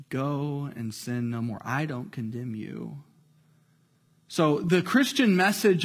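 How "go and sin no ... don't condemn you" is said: gentle. Acted. Jesus gently sends the woman off.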